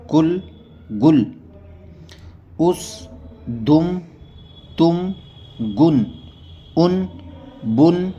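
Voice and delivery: each word said on its own, with pauses; reading drill. Shown as a demonstration. Teacher reads Qaida words to students, slow drill. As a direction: read the word list slowly for learners.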